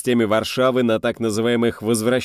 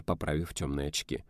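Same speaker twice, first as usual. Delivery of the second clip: plain reading voice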